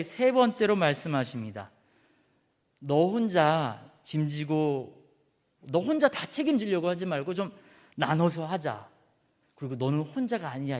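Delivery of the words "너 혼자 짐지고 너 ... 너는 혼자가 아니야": God's gentle counsel. Acted. God speaking gently to Elijah.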